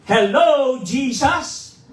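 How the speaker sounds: loud high shout to test the voice; shouted at the top of his voice. Shown as a demonstration. Pastor testing his voice after surgery with a shout. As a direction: belt it out full voice. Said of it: "sumigaw ako talaga on top of my voice".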